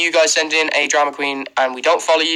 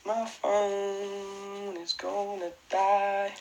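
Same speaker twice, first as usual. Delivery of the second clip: slow, much softer dying moan; mock despairing moan; 4 semitones higher, 13 dB quieter, much slower than usual. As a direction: drag it out like the phone's last breath. Repeating the phone complaint.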